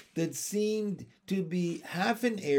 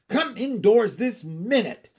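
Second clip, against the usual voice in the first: louder, higher cross command; scolding order; 8 semitones higher, 9 dB louder than usual. Acted. Miss Lark ordering Andrew indoors, scolding. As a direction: order him inside, brooking no delay. Owner scolds her disobedient dog.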